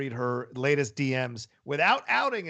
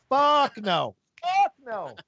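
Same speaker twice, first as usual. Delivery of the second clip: loud, higher-pitched disbelief; emphatic refusal; 8 semitones higher, 5 dB louder, much slower than usual. Acted. Meghan watching the princes talk, emphatic mock outrage.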